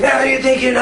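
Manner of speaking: high raging mock scream; imitated screaming rage. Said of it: "screaming in my face".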